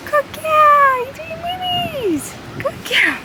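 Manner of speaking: high sing-song dog praise; squeaky praising a dog. Real, not acted. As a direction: coo at the puppy in a high voice. Praising puppy on first walk.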